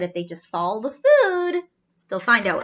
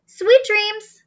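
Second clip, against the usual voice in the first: sweet goodnight; 10 semitones higher, 7 dB louder than usual. Acted. Robots say goodnight.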